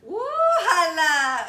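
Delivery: a loud, drawn-out whoop through laughter; whooping shout. Real, not acted. Host whoops at the customer story, loud laughing shout. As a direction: holler it slow and laughing.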